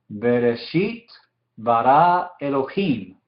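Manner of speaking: slow, word-by-word Hebrew recitation; slow careful reading. Shown as a demonstration. Teacher reading Genesis in Hebrew to students, slow and measured. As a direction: read it out slowly, separating each word.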